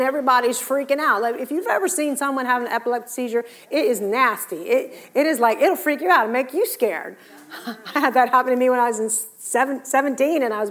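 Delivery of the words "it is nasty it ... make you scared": ordinary delivery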